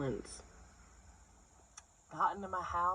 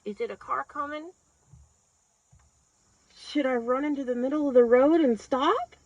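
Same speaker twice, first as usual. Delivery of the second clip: acted panic, pitched high, building louder; frozen deer blurting panicked questions; deer in headlights; 5 semitones higher, 8 dB louder than usual. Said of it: "deer in headlight".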